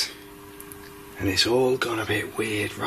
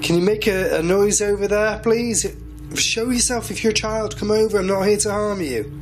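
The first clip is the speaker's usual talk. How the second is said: raised, high-pitched appeal to someone unseen; calling out; 7 semitones higher than usual. Real, not acted. Inviting a child spirit to come over, calling out high. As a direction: coax them out in a higher, projected voice.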